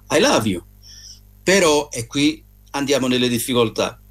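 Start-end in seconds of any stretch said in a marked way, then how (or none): 0.1-0.6 English phrase. Shown as a demonstration. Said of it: "in inglese invece si dice".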